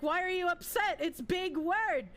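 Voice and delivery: high, rising mock child brag; proud child self. Streamer imitating their proud younger self, high mock brag. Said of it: "And being like".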